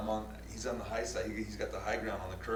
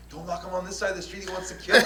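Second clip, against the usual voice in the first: mock plea; 9 semitones higher, 6 dB louder, faster than usual. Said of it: "I'm like".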